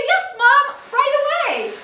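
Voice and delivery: loud, high, gung-ho yes; exaggerated keen agreement; eager obedience. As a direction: act out a kid jumping to help. Kid eagerly obeys mom's request.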